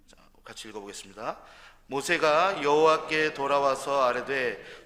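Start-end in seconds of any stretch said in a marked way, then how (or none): 1.9-4.9 slow, louder solemn reading; scripture reading. Real, not acted. Pastor leading the congregation in reading Exodus, solemn reading. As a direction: lead the church through the verse.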